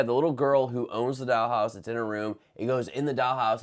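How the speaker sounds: normal talking voice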